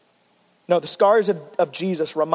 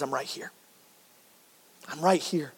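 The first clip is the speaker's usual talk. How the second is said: much softer, lower, gentle comfort; tender reassurance; 5 semitones lower, 10 dB quieter than usual. Retold or imitated. Jesus answering the grieving pastor, soft and tender. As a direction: soothe someone who is hurting.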